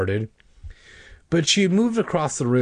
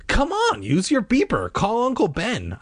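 high-pitched, insistent plea; acted exasperated urgency, starting very high; impatient urging; 12 semitones higher than usual. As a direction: press her to hurry up. Boy urges cousin to call for help.

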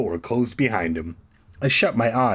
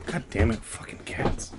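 under-breath curse, whispered; muttering; 7 dB quieter than usual. Real, not acted. Muttering about cat.

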